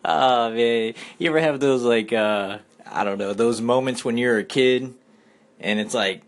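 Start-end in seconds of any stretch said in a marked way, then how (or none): none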